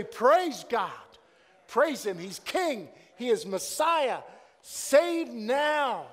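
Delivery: loud, high praise shouts; crying out praise. Shown as a demonstration. Pastor leading the congregation in loud praise. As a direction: call it out to the whole church. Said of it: "this pastor can cry out".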